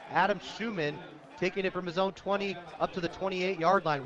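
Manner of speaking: normal talking voice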